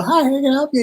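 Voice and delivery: bright, high service voice; cheery cashier greeting. Retold or imitated. Chick-fil-A worker greets a customer, high and cheerful. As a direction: chirp it like a friendly cashier. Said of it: "they go".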